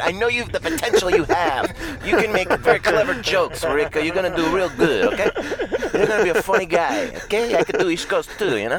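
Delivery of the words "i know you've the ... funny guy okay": higher, hammy Montreal guy voice; a mock Montreal accent. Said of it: "That's like a weird Montreal guy".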